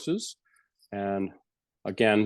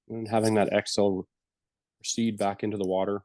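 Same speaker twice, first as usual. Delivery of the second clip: guided meditation. Acted. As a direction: ease the listeners gently back from the breath. Leads a closing meditation.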